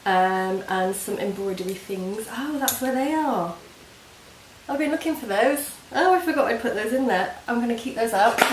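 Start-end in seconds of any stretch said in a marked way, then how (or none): 2.3-8.4 pleasantly surprised, high; oh, found them!. Real, not acted. Finds lost embroidery things.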